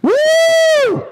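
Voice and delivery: shrill mock woo girl shout; woo cheer. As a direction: squeal a party woo. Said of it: "the Woo girls back there".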